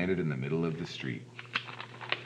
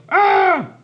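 loud high scream of fright; sudden shriek, far above normal pitch; frightened yell; 14 dB louder than usual. Acted. Father startled from behind by Lance, frightened yell. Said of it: "turned around and yelled".

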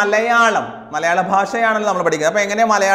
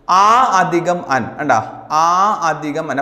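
slow dictation; 2 semitones lower, slower than usual. Shown as a demonstration. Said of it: "teacher dictates the split form".

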